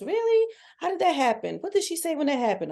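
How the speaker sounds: high-pitched, eager questioning; high incredulous gossip voice; feigned amazement. Acted. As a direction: squeal in surprise, then pry for details. Acting a curious listener.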